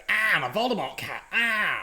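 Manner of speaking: high growly monster cat. Retold or imitated. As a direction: jump out as a scary cat. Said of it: "it jumps out and it goes".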